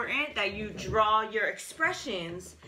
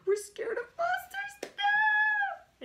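high-pitched pretend gasp and wail; playfully panicked, very high; mock scared; much slower than usual. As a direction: squeal in mock terror, drawing out the last word. Acting scared of child's monsters.

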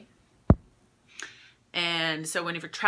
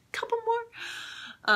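very high, slow, drawn-out "Couple more"; playful sing-song; much slower than usual. Shown as a demonstration. Host teasing viewers about the kit's last oils in a very high singsong.

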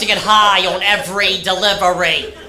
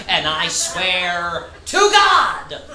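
usual speaking voice

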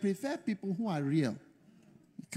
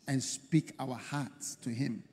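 low, soft, slow prayer voice; leading prayer; 6 semitones lower, 8 dB quieter, slower than usual. Real, not acted. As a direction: usher the congregation gently into prayer. Leads congregation into prayer.

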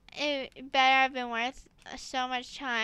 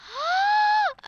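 sharp high gasp; 5 dB louder than usual. Real, not acted. Sees the calculator.

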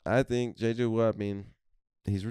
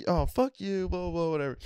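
much higher put-on angry voice; mock furious buddy; 10 semitones higher than usual. Heated buddy cursing out the old Pirates fan, high mock fury. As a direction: hurl the insult in a squeaky rage.

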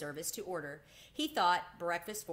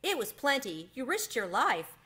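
amazed protest; 8 semitones higher, 5 dB louder than usual. A narrator's reading. Boy contradicts modest mouse.

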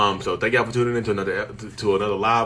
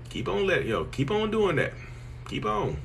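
mock warning; 8 semitones higher, 5 dB quieter, slower than usual. Mocking warning, high singsong.

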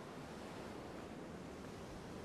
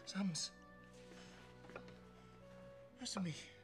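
soft, lower, desperate pleading; tender pleading; 3 semitones lower, 11 dB quieter, slower than usual. Acted. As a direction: beg softly over a sick child. Father pleads over sick Sams.

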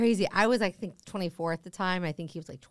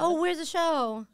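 curious Tinder guy; 8 semitones higher, slower than usual. Retold or imitated.

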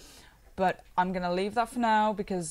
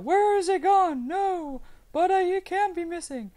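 loud, high-pitched mock panic; mock freak-out; 12 semitones higher, 8 dB louder than usual. Tutor acting out a viewer who lost the mesh, mock freak-out. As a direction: wail it in exaggerated panic. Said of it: "now you're gonna freak out".

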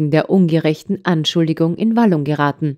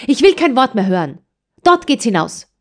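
curt order; 7 semitones higher than usual. A narrator's reading. Farmwife orders child out.

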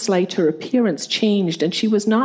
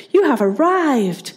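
excited exclaim; 5 semitones higher than usual. A narrator's reading. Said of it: "she exclaimed".

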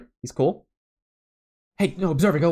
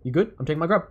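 usual speaking voice

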